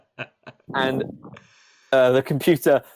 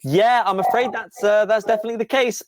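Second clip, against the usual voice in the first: high-pitched computer breaking bad news; apologetic computer; 8 semitones higher than usual. Computer confirms Crackle's report, apologetic and high. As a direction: pitch it up, politely regretful.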